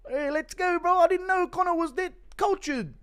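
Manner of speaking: high excited Kiwi bro voice; Kai Kara France impression. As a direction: hype it up like a Kiwi fighter. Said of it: "Impression of Kai Kara France thinking a Connor meltdown is the haka".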